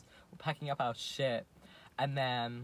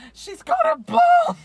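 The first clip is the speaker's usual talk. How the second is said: screaming a warning at full pitch; yelling; 21 dB louder, slower than usual. Retold or imitated. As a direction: shriek it out in panic. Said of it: "my friend yelled out".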